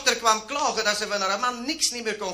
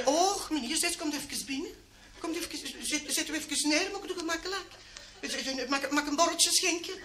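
coaxing hostess; 7 semitones higher, 6 dB quieter than usual. Acted. Woman coaxes visitor inside.

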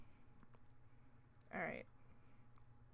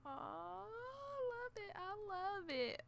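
soft adoring; 8 semitones higher, 5 dB quieter than usual. Real, not acted. Softly adoring the pet.

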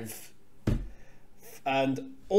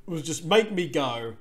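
normal talking voice

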